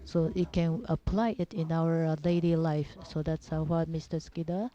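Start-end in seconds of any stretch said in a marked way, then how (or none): none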